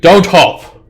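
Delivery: loud, high commanding yell; giant shout. Acted. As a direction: shout the order over them. Giant overrides player command.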